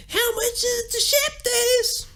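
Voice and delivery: very high, drawn-out mock rock screech. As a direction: wail an everyday question like a rock singer. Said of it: "At the post office".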